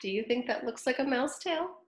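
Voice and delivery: plain reading voice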